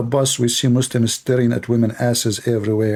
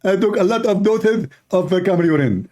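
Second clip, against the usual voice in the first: higher, put-on preacher voice confessing proudly; mock Zakir Naik; 5 semitones higher than usual. Mocking Zakir Naik blaming camel urine, higher put-on voice. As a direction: lampoon him, earnest and absurd. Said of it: "This is why he thinks this".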